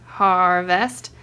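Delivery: HARVEST drawn out slowly in two parts; syllable by syllable. Shown as a demonstration. Pointing out the word in the book, slow syllables. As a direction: stretch HAR, then land VEST.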